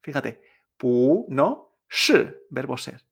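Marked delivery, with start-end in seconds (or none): 0.8-1.3 不 stretched and rising; second tone on 不. Shown as a demonstration. Teacher isolates the changed tone, 不 rising. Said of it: "cambia a un segundo". 1.9-2.5 是 thrown high and falling fast; fourth tone on 是. Shown as a demonstration. Teacher isolates the verb to be, 是 falling. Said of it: "un segundo cuarto".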